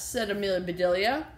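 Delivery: put-on lively read-aloud to children; story reading. A narrator's reading. Teacher reading the story to kids, lively. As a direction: read the chapter with bright character voices.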